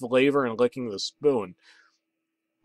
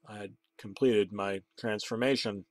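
ordinary delivery, nothing marked

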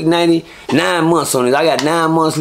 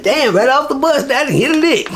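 much higher, delighted inner voice; thrilled; 9 semitones higher than usual. Retold or imitated. New arrival getting free goods, high delighted disbelief. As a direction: sound like you just got lucky.

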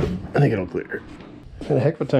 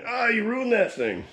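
mock commenter; 13 semitones higher than usual. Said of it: "some of you guys are screaming".

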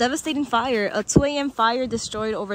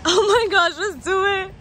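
giddy anticipation; 8 semitones higher, 9 dB louder than usual. Real, not acted. Excited at the photo board.

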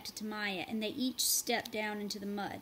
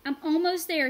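loud, higher call; called back; 7 semitones higher, 10 dB louder than usual. A narrator's reading. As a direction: call across to them. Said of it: "he called back".

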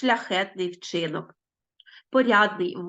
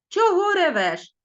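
mock gruff contempt, a little louder and slow; rude scolding; 9 semitones higher, 4 dB louder than usual. Rude classmate Denys scolding the fallen girl, mock gruff. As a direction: sneer at the crying girl. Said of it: "та й каже".

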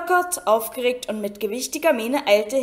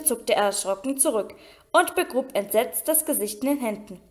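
ordinary delivery, nothing marked

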